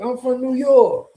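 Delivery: high-pitched boast in a put-on New York accent; mock New York accent. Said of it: "And then they get to New York".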